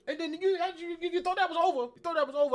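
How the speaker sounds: high, loud, fast streamer rant; xQc impression. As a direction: hammer it like a hyped streamer. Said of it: "my X Q C impression right there".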